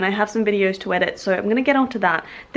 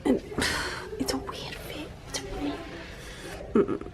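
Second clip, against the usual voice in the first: soft breathy whisper to herself; 3 semitones higher, 14 dB quieter than usual. Real, not acted. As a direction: keep it to a whisper. Whispering in the changing room.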